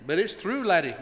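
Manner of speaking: a light stage-Irish lilt on laddie; lightly hammed Irish accent. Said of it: "My accent's not very good".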